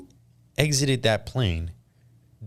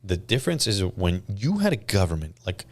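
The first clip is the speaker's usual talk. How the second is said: normal talking voice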